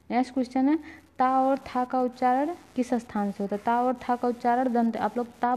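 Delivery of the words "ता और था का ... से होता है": ordinary delivery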